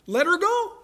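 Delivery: high rising question on "Let her go"; high-pitched, astonished echo of the ruling; incredulous onlooker. Acted. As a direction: question it in sheer disbelief. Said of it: "everybody else is sitting around going".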